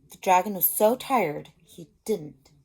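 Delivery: lively read-aloud to a class; a teacher's picture-book reading voice; story narration. A narrator's reading.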